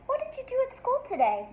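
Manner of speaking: high, fast, lightly voiced mom; a hint of an eager mom; mom's voice. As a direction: ask it like a chirpy mother. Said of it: "Pete's mom asks him".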